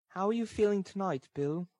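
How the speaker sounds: put-on high-pitched girl's voice; high voice for a concerned girl; girl's character voice. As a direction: ask it lightly in a high girl's register. Narrator voices Nancy to Sikes.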